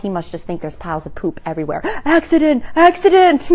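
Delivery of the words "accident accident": high imitation of a toddler's alarm; raised, mock-urgent announcement; toddler shouting. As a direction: call it out like a small boy pointing. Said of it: "points to it and shouts".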